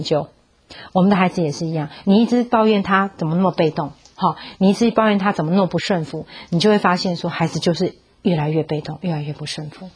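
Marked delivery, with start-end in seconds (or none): none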